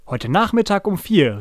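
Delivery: put-on storybook boy calling out; busy call. A narrator's reading. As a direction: announce it to passers-by. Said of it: "rief mein Bruder Jojo dann ganz beschäftigt".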